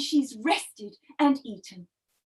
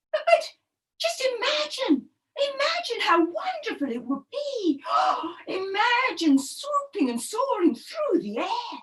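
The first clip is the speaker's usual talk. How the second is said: high, breathless, dreamy pleading; eager little turtle; 7 semitones higher than usual. Acted. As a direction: beg them to imagine it, higher, with a gasp.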